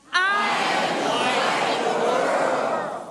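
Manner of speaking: loud, slow proclamation with a laugh in it. Shown as a demonstration. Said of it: "A little bit louder now".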